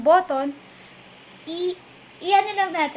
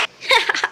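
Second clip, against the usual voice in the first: short laugh; 7 semitones higher than usual. Real, not acted. Laughs at chipmunk voice.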